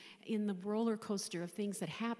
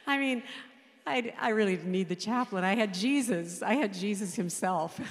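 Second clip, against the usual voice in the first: joyful exclamation; 2 semitones higher, 8 dB louder than usual. Real, not acted. Widow exulting to the congregation, laughing. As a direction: proclaim it with delight.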